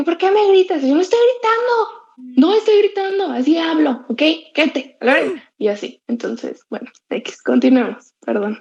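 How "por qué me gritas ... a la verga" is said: much higher, indignant protest; exasperated retold argument. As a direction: act out a stressed-out defence against being accused of yelling. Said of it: "la gente cree que estoy gritando".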